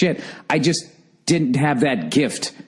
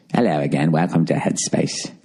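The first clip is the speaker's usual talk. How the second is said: put-on soothing British accent; 8 semitones lower than usual. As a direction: imitate a calm British guide, much lower. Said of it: "soothing voice like this, some sort of British accent".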